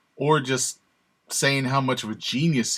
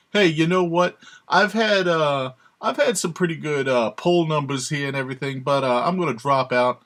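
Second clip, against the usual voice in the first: loud, raised mock-politician voice, settling as it goes; terrible Trump impersonation; 4 semitones higher, 4 dB louder than usual. Candidate casually quitting the race, badly imitated. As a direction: do a bad impression of a blustering candidate. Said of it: "That's a terrible Trump impersonation".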